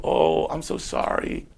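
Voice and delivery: high meek mock apology; arrested protester sweet-talking the police officer; apologetic pleading. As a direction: grovel to calm the officer down. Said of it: "But if I go".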